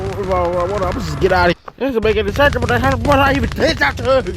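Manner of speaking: usual speaking voice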